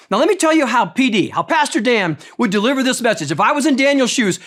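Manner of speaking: ordinary talk, nothing marked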